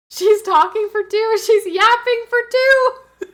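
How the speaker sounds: loud, high, last words stretched out; hammed-up wailing announcement; mock-excited yelling. Pregnant streamer jokes about double yapping, high playful yell.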